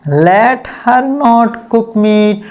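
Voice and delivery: each word drawn out very slowly; slow dictation. Shown as a demonstration.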